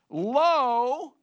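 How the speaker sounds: "Lo" high, loud and stretched out; extreme emphasis. Acted. Preacher quoting the New King James, emphatic "Lo". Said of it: "a word of emphasis".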